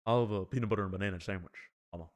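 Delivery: lightly voiced rock-and-roll King; playful Southern showman impression. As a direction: play the crooner ordering. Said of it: "was that an impression of [name]".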